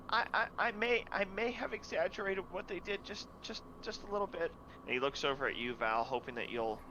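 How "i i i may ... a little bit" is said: put-on high, stammering child admitting it; a guilty kid mumbling a confession; sheepish boy.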